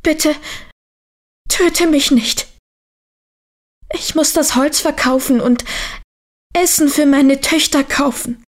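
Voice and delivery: high-pitched frightened begging; trembling plea. A narrator's reading. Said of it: "Der Holzfäller bat zitternd".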